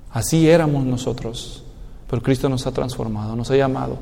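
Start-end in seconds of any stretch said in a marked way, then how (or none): none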